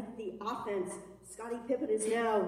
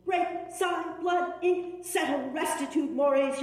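high loud cries; 7 semitones higher, 5 dB louder than usual. Acted.

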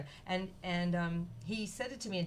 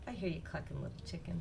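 tender goodnight; 6 dB quieter, faster than usual. A narrator's reading. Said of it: "she's just closing the door".

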